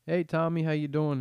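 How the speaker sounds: friendly greeting. Pretend. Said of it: "It's just like".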